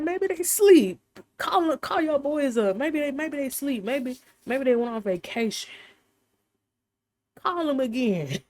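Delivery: high-pitched, teasing jeer with a loud start; mock taunting. Elijah jeering at the prophets of Baal, mock taunting.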